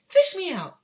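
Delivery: high, loud desperate cry; yell. Acted. Boy yelling from the river. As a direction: beg to be pulled out. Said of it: "he yelled".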